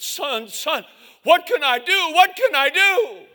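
rising high and loud; desperate plea. Retold or imitated. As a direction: beg for anything to do. Father holds dying son.

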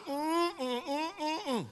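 high, soft listening hums; sympathetic humming. Retold or imitated. Pastor keeps listening for hours, soft high hums.

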